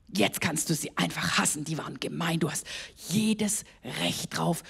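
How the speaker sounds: low, soft, hushed tempting whisper; sly hushed voice of the tempter; devil's whisper. Acted. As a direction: whisper like the devil luring him to hate. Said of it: "dass der Teufel zu ihm gesagt hat".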